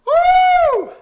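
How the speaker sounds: very high, loud whoop; celebration whoop. Real, not acted. Streamer whooping as the Leafs score, very high and loud. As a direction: let out a full victory whoop.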